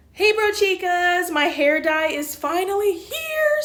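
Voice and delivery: loud, high, bursting with excitement; excited greeting. Real, not acted. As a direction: call out the news thrilled. Excited vlog greeting, dye arrived.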